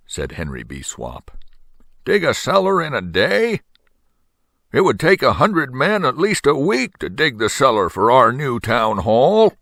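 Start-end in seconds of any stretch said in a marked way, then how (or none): 2.0-9.5 high, incredulous town official voice; scoffing selectman. Acted. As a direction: scoff at the absurd promise. Henry B. Swap scoffs at offer.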